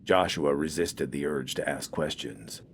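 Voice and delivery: usual speaking voice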